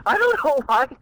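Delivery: high laughter through the words; laughing. Real, not acted. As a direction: giggle helplessly while talking. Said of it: "You're an easy laugh".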